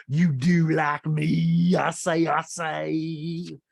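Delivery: slow, drawn-out mock Southern twang; mocking Southern accent. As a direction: mimic a castmate's Southern accent to taunt her. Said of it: "Rinna mocks Sutton in the Southern accent".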